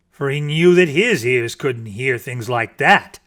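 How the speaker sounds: ordinary delivery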